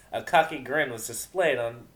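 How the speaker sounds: ordinary delivery, nothing marked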